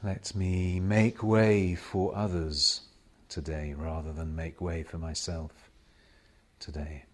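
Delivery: slow, hushed prayer with long silences; meditative prayer. Real, not acted. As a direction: pray each short petition, leaving space after it. Meditative prayer of self-surrender.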